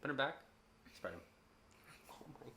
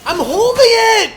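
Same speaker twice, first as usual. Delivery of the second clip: high, slow desperate yell; 18 dB louder, slower than usual. Real, not acted. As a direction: stretch the yell out in frustration. Frantic in a fight.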